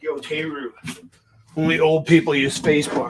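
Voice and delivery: lightly voiced Brooklyn accent students; a hint of a Brooklyn accent. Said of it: "I love when you do the Brooklyn accent".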